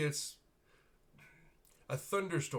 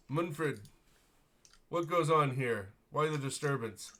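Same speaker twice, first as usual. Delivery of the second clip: haughty noble; 4 semitones higher, 6 dB louder than usual. Acted. Gaunt noble voiced by GM.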